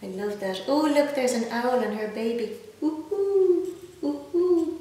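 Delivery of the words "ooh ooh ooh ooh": higher owl hooting. Retold or imitated. Reader hooting like the owl for the child, higher. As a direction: hoot like a little owl.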